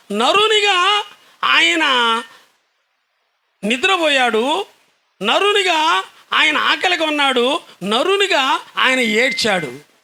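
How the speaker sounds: high, emphatic sermon delivery in rhythmic phrases; impassioned preaching. Acted. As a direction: proclaim each parallel phrase with rising force. Preacher contrasts man and God.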